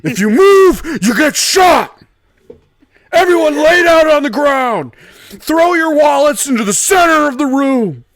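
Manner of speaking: loud, high robber shouting. Acted. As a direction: shout orders at the room. Robbery role play.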